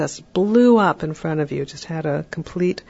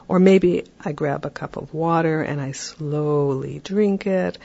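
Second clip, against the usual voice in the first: ordinary talk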